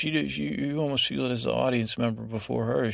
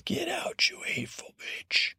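whispered, venomous dismissal; harsh whisper; 4 dB quieter than usual. Retold or imitated. Margaery hissing at Cersei to leave, in a venomous whisper. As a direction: spit it out under your breath.

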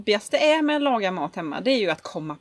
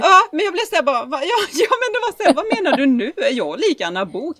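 laughing; 8 semitones higher, 4 dB louder than usual. Retold or imitated. Laughing retelling, high and fast.

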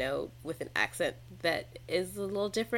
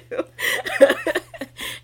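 laughing; 11 semitones higher, 13 dB louder than usual. Real, not acted. Laughing at her own quip.

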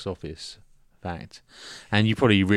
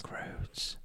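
very soft whispered echo; mock-sinister whisper; ominous whisper; 18 dB quieter than usual. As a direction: breathe it as a spooky fading echo.

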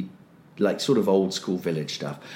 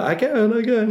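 much higher, chirpy keen voice; eager mock boast; 14 semitones higher, 3 dB louder than usual. Nell insisting she can do the trick, hammed-up. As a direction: squeak it out like a keen child.

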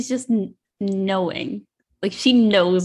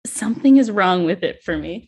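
usual speaking voice

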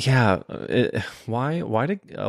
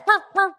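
high yappy bark; high mock barks; imitated dog bark. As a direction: imitate a dog yapping from another room. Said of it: "He's in the other room going".

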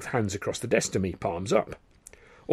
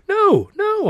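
much higher, loud alarmed denial; cried out alarmed; 7 dB louder than usual. A narrator's reading. Dando cries out in alarm to Carno. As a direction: blurt it out in alarm. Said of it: "I cried out".

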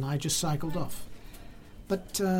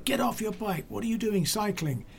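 high-pitched mock telling-off; mildly acted indignant challenge; retold scolding; 6 semitones higher than usual. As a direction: imitate a man still demanding, without shouting. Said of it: "and he was still".

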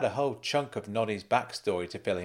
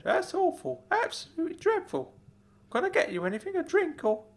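high uncle voice, full of sympathy; Uncle Claude, sympathetic. Acted. Uncle consoles nephew over lost friend, high and concerned. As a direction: commiserate in a much higher character voice.

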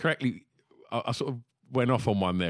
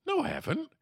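high-pitched indignant denial; retold denial, pitched way up; protesting denial. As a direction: squeak out a mock protest. Acts out denying his lisp.

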